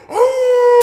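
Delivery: high, loud howl like a wolf. Retold or imitated. Imitating the wolf's cry to the caller, a loud high howl. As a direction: throw back your head and howl. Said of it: "and they go".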